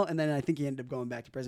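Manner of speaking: ordinary talk, nothing marked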